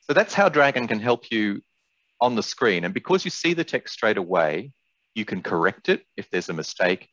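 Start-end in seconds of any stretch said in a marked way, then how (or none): none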